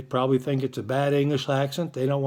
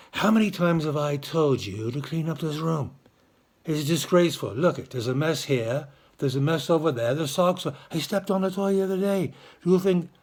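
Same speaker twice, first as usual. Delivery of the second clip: scolding in a put-on English accent; amateur English accent, restrained reprimand. Shown as a demonstration. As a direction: chide like an English parent, without yelling. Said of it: "do it like an English person".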